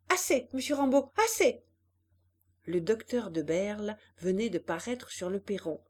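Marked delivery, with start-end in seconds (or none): 0.1-1.6 high, loud call to stop; crying out. A narrator's reading. Hélène on the swing telling Rambaud to stop, high loud cry. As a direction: cry out for him to stop pushing. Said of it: "elle cria".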